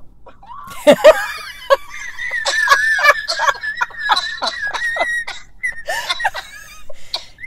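loud, high whooping laugh; loud laugh shout. Real, not acted. Overcome in conversation, breaking into a loud whooping laugh. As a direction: let out a big shouting laugh. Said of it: "you fall out like nobody's business".

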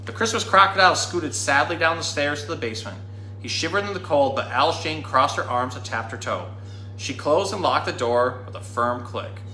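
ordinary delivery, nothing marked

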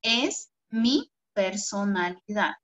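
slow, clear dictation pace. Shown as a demonstration. Teacher dictating homework to pupils, slow and clear. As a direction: spell it out slowly so they can write. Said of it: "va con tilde en la primera O".

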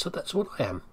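normal talking voice

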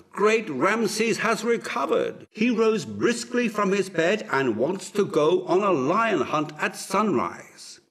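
exultant herald's shout; jubilant cry. A narrator's reading. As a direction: proclaim the great news at full voice. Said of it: "cried the arrival".

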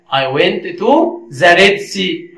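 very slow, evenly spaced sentence; slow model answer. Shown as a demonstration. Teacher showing learners the completed sentence, slow model answer. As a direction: give the answer slowly, word by word.

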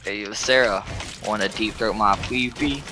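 manly voice. Pretend. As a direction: push the voice down like a tough guy. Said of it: "in, like, a manly voice".

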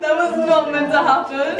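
startled, laughing through the words; real giggly surprise, pitch rising. Real, not acted. Champagne cork pops unexpectedly.

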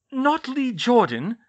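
high, loud, slow disbelief; horrified. A narrator's reading. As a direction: draw out the question in horror. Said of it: "Pomfrey said in horror".

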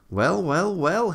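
high, lilting well, well, well; storybook engine marvelling; amused exclamation. A narrator's reading. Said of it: "he exclaimed".

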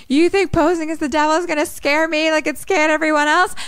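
high, frantic mock outburst; freaking out. Mickey accuses Robbie D of framing him, acted freak-out. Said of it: "Mickey just starts screaming. He's freaking out".

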